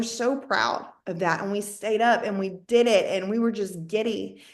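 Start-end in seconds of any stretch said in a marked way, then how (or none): none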